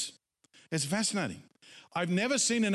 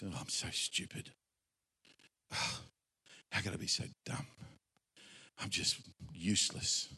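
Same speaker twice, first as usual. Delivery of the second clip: hushed, low self-reproach with heavy pauses; self-condemning; 9 semitones lower, 8 dB quieter than usual. Acted. Preacher voicing someone agreeing with the devil, hushed self-condemning. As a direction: sigh and beat yourself up under your breath. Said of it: "when you start saying things like this".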